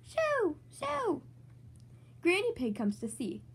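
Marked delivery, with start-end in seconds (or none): 0.0-1.2 high shooing call. Acted. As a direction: wave the bird away. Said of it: "says Grandpa".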